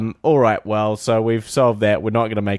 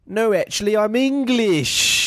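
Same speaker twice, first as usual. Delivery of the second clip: mock English accent; 9 semitones higher than usual. Co-host improvises for the audition, mock English accent. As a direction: play up a hammy English accent. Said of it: "In an English accent".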